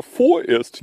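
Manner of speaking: pointed emphasis on "vorerst"; stress on vorerst. Real, not acted. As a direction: lean hard on the VOR of "vorerst". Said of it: "ich betone vorerst".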